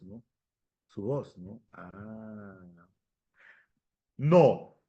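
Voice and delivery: usual speaking voice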